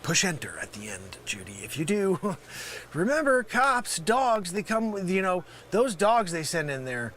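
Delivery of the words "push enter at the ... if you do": sudden hush. Acted. Drop after the yelled warning.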